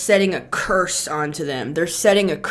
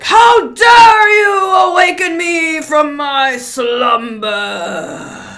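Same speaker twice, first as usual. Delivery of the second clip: slow, thundering shout, much higher and louder; booming voice of God; 8 semitones higher, 10 dB louder, much slower than usual. Acted. Angry god roaring at those who woke him, slow booming shout. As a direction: roar it down from the heavens like thunder. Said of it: "all booming voice and everything".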